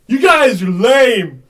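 slow mocking taunt. Taunting in scene.

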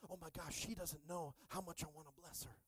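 hushed, eager aside as if bursting with a secret; excited. Acted. God eager to bless her, softly excited. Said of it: "He gets excited going".

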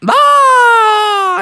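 high, loud yelp of fright; very high startled scream; frog's scream. Acted. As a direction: shriek as you're carried off. Froggy snatched into the sky.

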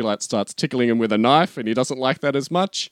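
ordinary talk

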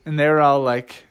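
ordinary delivery

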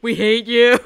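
mock-hateful, laughing yell; heckling crowd. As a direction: shout it as the whole queue. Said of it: "everyone goes, like, straight face again".

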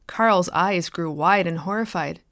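usual speaking voice

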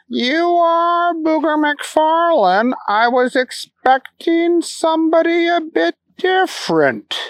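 high, slow, doubtful formal voice; puzzled manager. Acted. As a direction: speak as a baffled prim manager. Asquith voiced.

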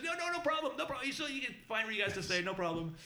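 high, hurried reassurance; starts high, settles as it reassures; reassuring Irish mother. Retold or imitated. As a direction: imitate a hostess brushing off worry. Acted mother hastily reassures guests.

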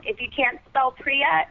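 ordinary talk, nothing marked

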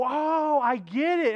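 high-pitched mock "aha" moment; mock realization. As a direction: play someone who thinks they've got it. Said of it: "You go".

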